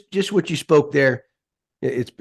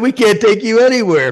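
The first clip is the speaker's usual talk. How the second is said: loud, high teasing exclamation; mock scolding; 7 semitones higher, 8 dB louder than usual. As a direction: razz a friend with a playful loud jab. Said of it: "I said".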